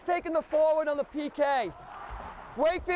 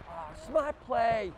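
ordinary talk, nothing marked

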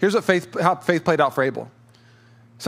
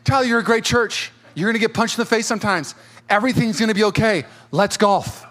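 upbeat mentor; 6 semitones higher than usual. Retold or imitated. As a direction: clap him on the back with your voice. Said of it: "Hits me on my shoulder and he goes".

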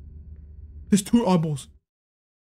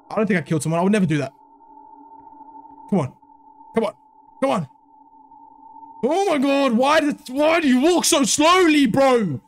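usual speaking voice

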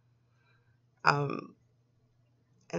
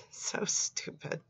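under-breath scoff; 9 dB quieter, slower than usual. Real, not acted. Scoffing at the creepy chat.

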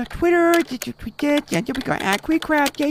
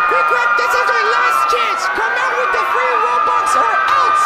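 shouted threat; 4 semitones higher than usual. Acted. Threat shouted outside the house.

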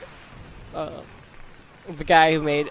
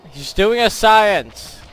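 retold scream; 5 semitones higher, 9 dB louder, slower than usual. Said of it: "I screamed".

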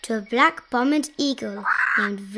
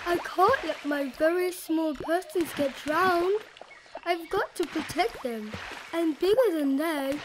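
worried elephant; 6 semitones higher than usual. A narrator's reading. Elephant vowing to protect his small persons, gently worried. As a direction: fret gently over tiny charges.

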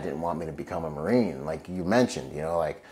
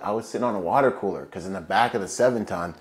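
ordinary delivery

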